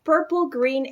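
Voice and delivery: usual speaking voice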